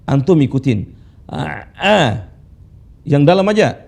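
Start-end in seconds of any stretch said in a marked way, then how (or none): 1.3-2.0 A, ai, au from the middle of the throat; deep throat ain. Shown as a demonstration. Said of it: "Perhatikan cara baca ain". (ain dibaca dari tengah tenggorokan)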